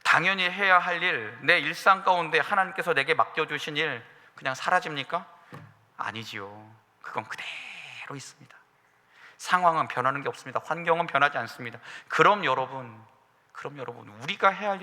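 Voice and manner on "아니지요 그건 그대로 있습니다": whisper. Acted. Quiet aside on unchanged duty.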